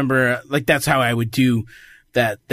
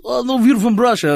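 raised-pitch fake Russian accent; mock Russian accent, higher voice; put-on Russian accent; 10 semitones higher, 4 dB louder than usual. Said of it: "with a Russian accent".

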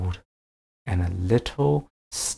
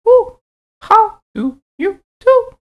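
a friendly owl, high and hooting; 11 dB louder than usual. Acted. Owl figure voiced.

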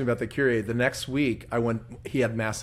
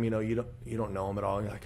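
usual speaking voice

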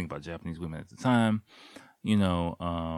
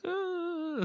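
much higher, tiny embarrassed "Uh"; shy girl squeak. Retold or imitated. Imitating girl's shy noise.

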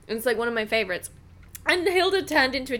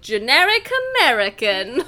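generic American accent; 5 dB louder than usual. Shown as a demonstration. Joking about own accent work, mock generic American accent. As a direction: put on a generic American accent, a bit loud. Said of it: "If I'm doing an accent".

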